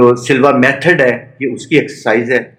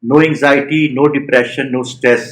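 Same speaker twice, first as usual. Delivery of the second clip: guided meditation; 4 dB quieter than usual. Real, not acted. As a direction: lead a body scan one short phrase at a time.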